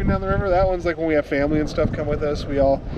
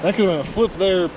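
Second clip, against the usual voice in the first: excited; faster than usual. Real, not acted. After close call on rapid.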